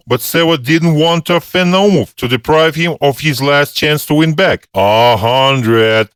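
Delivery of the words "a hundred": ordinary delivery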